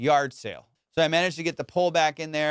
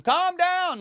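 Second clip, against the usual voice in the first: high, drawn-out call across water; imitated calming yell; 14 semitones higher than usual. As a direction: call out as the friend on the paddleboard. Imitating friend calling across water.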